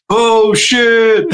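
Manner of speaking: high, drawn-out crowd howl; crowd hyping the insult. Acted. Everybody else adds to the insult, high mock-shocked hooting. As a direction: hoot like onlookers after a sick burn. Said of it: "when everybody else, like, starts to go, going".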